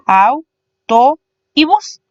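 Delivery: AU, TO and BUS spaced out slowly, each on its own; syllable by syllable. Shown as a demonstration. Teacher splitting autobús for students, syllable by syllable. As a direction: count off "au", "to", "bus" with pauses. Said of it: "se divide en tres sílabas".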